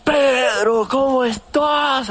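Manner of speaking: put-on overjoyed drunk buddy, high and loud; drunk friend. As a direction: hail an old friend like a happy drunk. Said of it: "borrachísimo".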